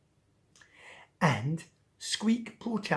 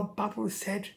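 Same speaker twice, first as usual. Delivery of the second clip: plain reading voice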